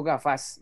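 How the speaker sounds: "gafas" stressed on the last syllable, ga-FAS; wrong stress. Shown as a demonstration. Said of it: "Yo digo es gafas".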